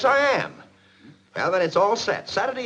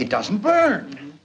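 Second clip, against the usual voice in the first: stunned disbelief; 3 dB louder, much slower than usual. Acted. Paint fails to catch fire.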